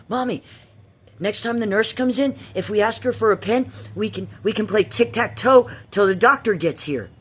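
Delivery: put-on high, loud little-girl voice; childlike plea. Bored girl asking stepmom for tic-tac-toe, high childlike. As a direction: ask sweetly like a bored kid. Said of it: "I was like".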